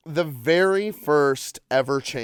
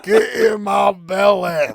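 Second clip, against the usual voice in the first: loud, higher Scottish shout; Fat Bastard Scottish; 6 semitones higher, 6 dB louder than usual. Retold or imitated. Host shows his only Scottish accent, a loud bellow. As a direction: bellow it like a greedy Scottish brute. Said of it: "my Scottish accent is just fat bastard".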